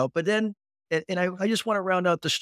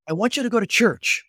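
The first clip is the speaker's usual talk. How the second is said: higher mock mom, insisting; lightly imitated mother on the phone; insistent mother; 5 semitones higher than usual. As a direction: voice the mother firmly telling her son to church. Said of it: "mom's calling by her phone".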